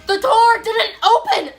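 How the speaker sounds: high, loud, slow cry of disbelief; exasperated outburst. Real, not acted. Retelling the stuck door to a friend, loud exasperated wail. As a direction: wail it, laughing at the disaster.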